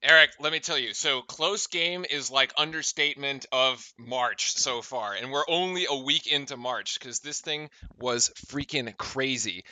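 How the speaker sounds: casual low-key recap voice; highlights commentary intro. Acted. Host setting up the highlights, relaxed.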